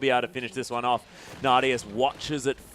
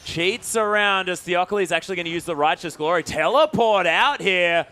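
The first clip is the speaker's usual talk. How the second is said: live play-by-play; 7 semitones higher, 6 dB louder than usual. Real, not acted. Caster calling the flash and teleport escape, excited play-by-play. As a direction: call the escape at full pitch, stretching the final words.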